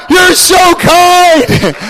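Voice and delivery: slow, high shouted cry of wonder; awed creatures. Acted. Creatures shout to God, slow and high. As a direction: stretch it out in a loud cry of awe.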